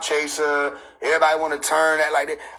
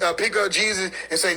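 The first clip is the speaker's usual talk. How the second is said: ordinary talk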